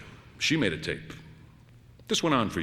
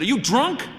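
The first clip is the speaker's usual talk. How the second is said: much higher and loud, incredulous; outraged shriek; 13 semitones higher, 5 dB louder than usual. Acted. Wife appalled by her husband's question, shrieking. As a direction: shriek it in disbelief.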